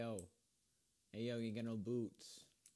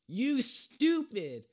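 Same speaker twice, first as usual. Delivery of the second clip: furious scream, slow and higher; swearing tirade; 12 semitones higher, 14 dB louder, slower than usual. Real, not acted. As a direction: scream the insult at him. Raging at another player.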